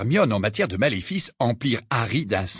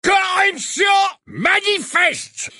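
much higher, slow booming roar; 5 dB louder, much slower than usual. Acted. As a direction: bellow it out like an outraged old portrait. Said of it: "rugit le portrait".

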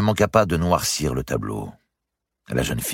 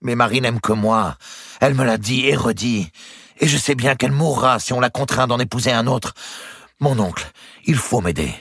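higher, pleading urgency; impassioned young lover; 10 semitones higher, 4 dB louder than usual. Acted. Nephew begging his uncle to save his love, impassioned. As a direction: plead your love, pitched up and insistent.

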